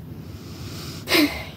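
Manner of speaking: long breathy sigh; heavy sigh. Acted. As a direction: let out a slow sigh. Pause after the memory.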